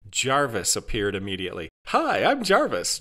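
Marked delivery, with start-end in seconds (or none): 1.9-3.0 high, drawn-out customer-service hello; pleasant greeting. A narrator's reading. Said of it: "Jarvis said pleasantly".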